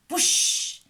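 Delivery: breathy gust of wind; whooshing wind. A narrator's reading. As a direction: blow like a gust. Wind sound effect.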